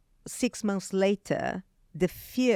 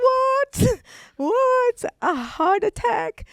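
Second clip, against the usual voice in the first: shrieked disbelief, far higher and louder; retold shocked cry; 8 dB louder, slower than usual. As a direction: shout it in stunned disbelief, slowing on heart attack. Hearing husband had heart attack.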